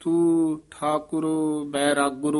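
slow ਤੂੰ Thakur, then a clear stop after ਬੈਰਾਗੁਰ; ਤੂੰ Thakur ਬੈਰਾਗੁਰ with a held pause at the comma; pause after bairagur. Shown as a demonstration. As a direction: read slowly and rest after ਬੈਰਾਗੁਰ. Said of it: "ਇੱਥੇ ਵਿਸਰਾਮ".

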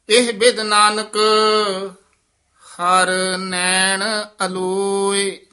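slow, drawn-out chanted recitation of scripture. Shown as a demonstration. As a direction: recite each phrase slowly and reverently, half sung. Teacher recites gurbani slowly.

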